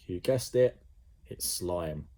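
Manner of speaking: ordinary delivery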